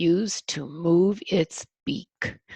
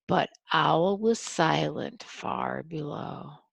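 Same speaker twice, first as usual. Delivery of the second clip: ordinary delivery, nothing marked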